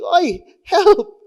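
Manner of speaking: high, loud yelp with a laugh; hammed-up call for help from the sinking boat; panicked cry for help. Preacher playing Peter's crew, mock yelling for help as the nets break. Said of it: "he starts screaming".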